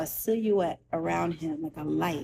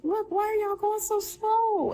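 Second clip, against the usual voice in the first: high-pitched tearful complaint; retelling a desperate, crying protest; retold crying plea; 11 semitones higher than usual. Crying patient begging the ambulance crew to hurry, high retold plea. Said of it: "And I'm s-, crying".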